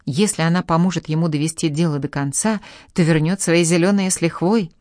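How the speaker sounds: plain reading voice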